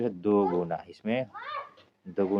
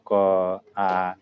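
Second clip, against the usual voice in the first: loud, slow reading of options; 9 dB louder, much slower than usual. Shown as a demonstration. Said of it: "कौन सा दीर्घ स्वर का उदाहरण है".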